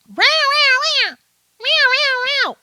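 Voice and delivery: high, loud imitated cat meows; cat meowing. Vlogger imitating the AI cat pointing at the dog, high meows. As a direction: meow like an accusing cat. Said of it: "the cat was going".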